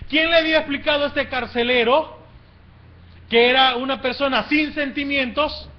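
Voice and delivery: high, loud preacher's rhetorical question; impassioned preaching. Real, not acted. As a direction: preach it at full force, phrase by phrase. Preaches at full force.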